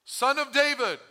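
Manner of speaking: high, loud cry for attention; yelling. Acted. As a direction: shout it as a blind man by the road. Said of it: "I was yelling".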